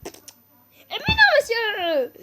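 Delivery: very high, loud, stretched-out slow cry; drawn-out protest; dismayed wail. Real, not acted. Vlogger protesting a wrong guess with a slow high wail.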